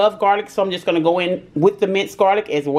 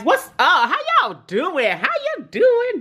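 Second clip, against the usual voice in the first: much higher, teasing greeting in a put-on voice; playful high voice; 11 semitones higher than usual. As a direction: sing out the hello like a silly character.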